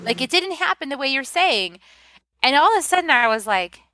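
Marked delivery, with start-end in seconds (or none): 0.1-1.8 higher, a hint of a dismissive mother; mother's retort. Quoting her mother, a lightly voiced dismissive retort. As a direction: toss it off as the doubting mother. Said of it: "she's like".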